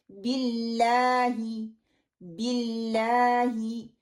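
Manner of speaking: बिल्लाही slowly with a short clear "bi" and firm "ll"; the right बिल्लाही; correct bi. Shown as a demonstration. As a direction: recite बिल्लाही cleanly and slowly. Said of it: "मैंने क्या करना है".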